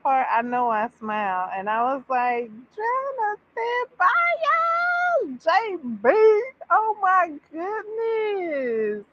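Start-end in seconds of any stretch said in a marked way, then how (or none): 2.7-6.1 high, drawn-out cheer of a fan; thrilled, stretched-out fangirl shriek; excited squeal. Retold or imitated. Squealing over favourite character's big moment. 6.7-9.0 high, slow, drawn-out gushing; real swooning fan squeal; overwhelmed. Real, not acted. Overcome by the moving scene.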